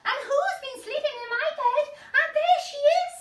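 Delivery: very high, fast, loud little bear; an excited little bear spotting her; Baby Bear. Acted. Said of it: "said Baby Bear".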